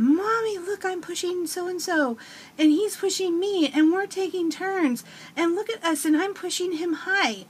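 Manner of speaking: put-on high, excited little-boy voice calling out; excited child calling. Son calling to mom across the playground, high and excited. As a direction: call across the playground like a proud four-year-old. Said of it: "yelled at me from across the playground".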